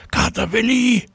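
high, sustained call. A narrator's reading. Girl calls the cat while flying.

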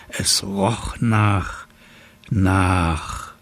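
slow, drawn-out suspense; slowly dawning suspicion; hesitant realization. A narrator's reading. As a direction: stretch it out, searching for the smell. Slowly working out the smell.

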